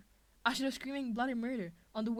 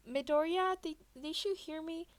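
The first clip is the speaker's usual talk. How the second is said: a hint of a high, nervous girl; 7 semitones higher, 3 dB louder than usual. A narrator's reading. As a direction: ask again, timidly worried. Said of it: "So she tried again".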